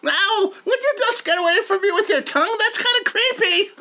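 high cartoon rabbit voice; squeaky cartoon rabbit; Robert the Rabbit. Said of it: "Robert the Rabbit".

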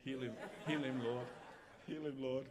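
chuckling through a joke prayer; laughing mock prayer. Preacher jokingly praying over the interpreter, laughing. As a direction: pray for him while laughing.

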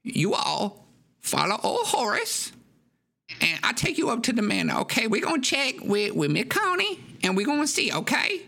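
put-on high coaxing old man; squeaky reassuring host; Horace voice. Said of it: "He says".

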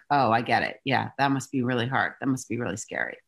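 gently meet their worry; empathetic. Retold or imitated. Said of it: "We're in empathy".

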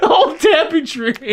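high, slow, laughing. Pretend. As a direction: giggle the words out slowly. Laughing goofy voice.

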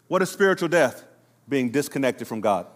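ordinary talk

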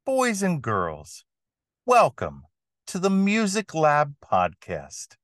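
showman announcing the act; booming circus ringmaster intro. Acted. Ringmaster style podcast opening.

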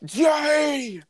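high shouted J; shouted answer. Acted. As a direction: blurt it out high.

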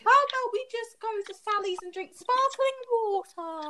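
high, slow, airy posh voice; mock the relaxed older students; posh carefree teen. Mocking sixth formers who get to wait, airy posh voice. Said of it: "they're eighteen being like".

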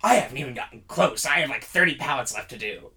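mildly exasperated retort; unhappy. Retold or imitated. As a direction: imitate a fed-up, put-upon worker. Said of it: "She does not look happy".

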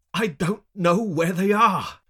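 high, loud, drawn-out protest; anguished, slow shout; pained cry. A narrator's reading. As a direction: cry it out through pain. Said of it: "I wince in pain".

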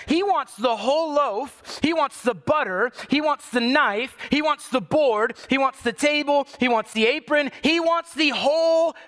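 preaching crescendo; building sermon climb. Real, not acted. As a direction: pile it on, rising toward the whole bakery.

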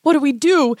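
put-on panicked boy. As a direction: ask your sister in a panic. Panicked boy asks his sister.

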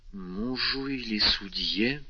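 slow, faltering question; faltering babble. A narrator's reading. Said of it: "пролепетала госпожа де Вильфор".

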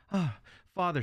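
put-on high, breathless girl's cry. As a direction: cry out to father in relief. Girl runs home to father.

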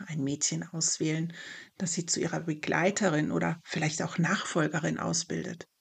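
ordinary delivery

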